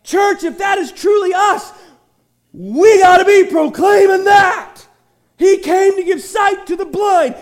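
loud, high, ringing preacher's cry; preaching at full force. Acted. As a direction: proclaim it to the whole church at full force.

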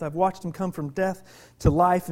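usual speaking voice

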